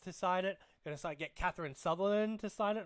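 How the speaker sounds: usual speaking voice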